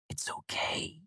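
whispered comfort, very faint; tender whisper; soft whisper. A narrator's reading. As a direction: barely breathe it. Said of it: "Auri said softly".

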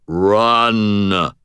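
booming machine voice; a hint of arcade game voice; resonant baritone. A narrator's reading. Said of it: "said the voice on the Sinistar game".

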